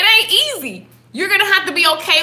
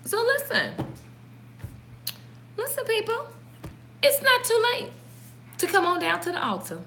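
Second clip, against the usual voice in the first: higher, soft preacher's call; mock altar call; 7 semitones higher, 10 dB quieter than usual. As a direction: invite them forward like a preacher, playfully. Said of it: "This altar call".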